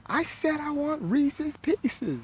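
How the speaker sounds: grandma spelling it out, exasperated; high, slow grandma voice; imitating grandma. Grandma repeating the order.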